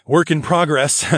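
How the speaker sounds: amused chuckle on the line; chuckling. A narrator's reading. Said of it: "I chuckled".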